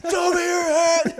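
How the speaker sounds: slow, high, loud yell; cop shout. Retold or imitated. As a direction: bark like a cop. Imitating cop line.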